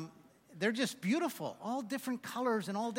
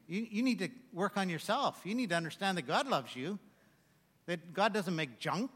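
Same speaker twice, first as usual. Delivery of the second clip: ordinary talk, nothing marked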